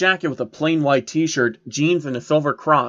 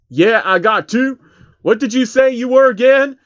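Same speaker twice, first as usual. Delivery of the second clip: higher, louder mocking question; louder cocky rapper voice; 9 semitones higher, 6 dB louder than usual. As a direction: toss it off like a smug tough guy. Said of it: "T I asked".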